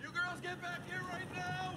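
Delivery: high, commanding holler; angry yell. Acted. Dad ordering girls back, angry high yell. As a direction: yell after them, pitched high. Father yelling after fleeing daughters.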